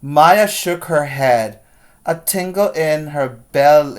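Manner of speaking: put-on lively storybook reading for kids; read-aloud story voice. A narrator's reading. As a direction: read it like a teacher to the class.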